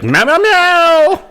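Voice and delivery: high, slow meow; high drawn-out cat meows; mock cat meowing. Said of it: "He's like".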